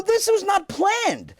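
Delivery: very high, slow mock protest; mock panic. Husband protesting the pregnancy news, high mock panic. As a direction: squeal it out in comic dismay. Acted quarrel over pregnancy.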